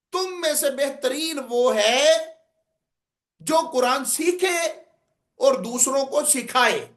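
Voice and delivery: slow, drawn-out orator's recitation with rises and falls; measured, ringing religious oratory; oratorical delivery. Shown as a demonstration. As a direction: deliver the hadith like a public speaker, stretching key words. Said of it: "انداز خطابت میں".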